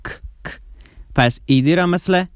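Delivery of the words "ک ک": whispered "ک، ک", pure breath; voiceless k sound. Shown as a demonstration. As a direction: breathe out "ک" twice with no voice. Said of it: "ک لرزه نداره".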